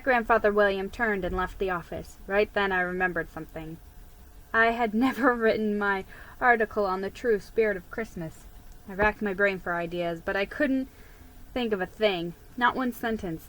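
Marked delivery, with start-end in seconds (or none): none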